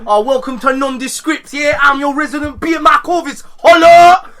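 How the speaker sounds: high, loud presenter shout; hyped host. Retold or imitated. Introducing the podcast host, loud hyped announcer. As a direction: announce it like a showman. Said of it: "Like, Mac".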